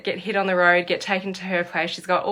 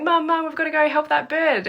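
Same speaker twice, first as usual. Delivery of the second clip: put-on high, urgent child's plea; imitating kids begging mum, voice much higher; kids pleading; 9 semitones higher than usual. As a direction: beg like an eager kid. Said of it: "my kids were going".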